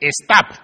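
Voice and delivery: "stop" said as "estop", with a Spanish E sound in front; stop with an added E. Shown as a demonstration. Preacher showing how Spanish speakers say "stop" as "estop". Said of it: "le ponemos la E".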